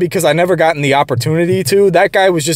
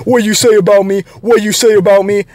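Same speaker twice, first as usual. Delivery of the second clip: higher-pitched mimicry of someone acting tough; mock tough-guy; 6 semitones higher, 3 dB louder than usual. Coworker confronting him after being told off, mock tough-guy. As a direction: imitate a confrontational posturer getting in someone's face. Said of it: "act tough around you".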